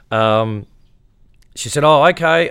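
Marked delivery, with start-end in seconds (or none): 1.8-2.5 surprised. Retold or imitated. Wife's reply.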